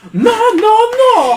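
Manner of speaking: shrieking high, loud no; troll scream. Acted.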